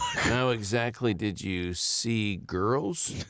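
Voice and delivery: slow, odd questioning voice; character voice. Acted. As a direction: ask it slowly in character. Player voices suspicious question.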